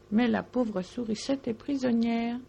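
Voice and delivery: ordinary delivery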